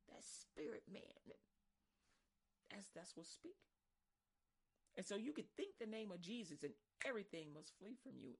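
ordinary talk

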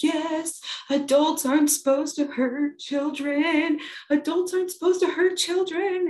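high, singsong mock wail; hammed-up tearful kid voice, high-pitched; sobbing child. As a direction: imitate a little girl sobbing out a complaint. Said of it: "It's, like, sobbing".

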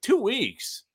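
high disbelieving two weeks; an outraged patient on the phone; incredulous. Retold or imitated. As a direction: echo it back in shock. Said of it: "I'm like".

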